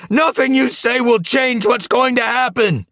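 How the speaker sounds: high, loud, forceful yell; full-voiced high-pitched outburst; furious shout. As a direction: shout them down. Villain shouts a threat.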